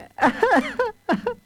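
high, gleeful cackle; wicked guards bursting into laughter; cackling laughter. A narrator's reading. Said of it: "bursting into laughter".

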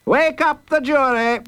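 high, gruff demand; gruff judge. A narrator's reading. Judge ordering the sleeping jury woken, gruff. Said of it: "he demanded gruffly".

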